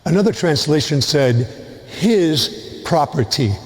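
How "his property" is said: slow "his property" with "his" punched; stress on his. Shown as a demonstration. Priest explains the translation, stressing "his". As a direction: hit the word "his", let "property" fall. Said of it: "with the emphasis on his".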